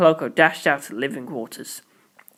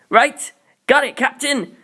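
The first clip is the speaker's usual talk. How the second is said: shouted; 9 semitones higher, slower than usual. A narrator's reading. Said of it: "Pocoloko shouted".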